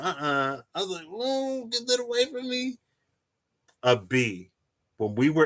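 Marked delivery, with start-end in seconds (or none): 0.0-2.8 high, hushed recoil; mock panic. Pushing the teacher's mantis away, high mock panic. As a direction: back away from the bug in a squeak.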